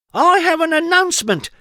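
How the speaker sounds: high, loud mischievous announcing; grand announcement. A narrator's reading. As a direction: proclaim it to the crowd. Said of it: "announced little Miss Trouble".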